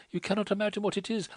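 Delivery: fast, rushed words; rapid. A narrator's reading. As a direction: hurry through it breathlessly. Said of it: "she went on rapidly".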